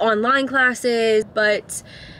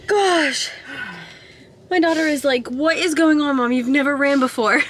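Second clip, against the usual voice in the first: after laughing hard; 3 semitones higher than usual. Real, not acted. Winded after running, laughing.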